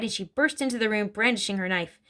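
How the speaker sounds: ordinary delivery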